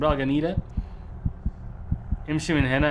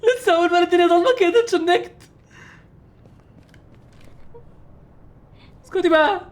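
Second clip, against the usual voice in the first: high, a bit louder, rattled voice; still shaken; 3 dB louder than usual. Real, not acted. Gamer still rattled after the scare, high shaken voice. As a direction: talk fast through leftover fright.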